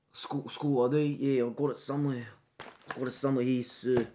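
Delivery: low, soft, mumbling schoolboy; timid student. Acted. Shy boy answering a pushy teacher, soft and low. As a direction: shrink away from the teacher, quietly.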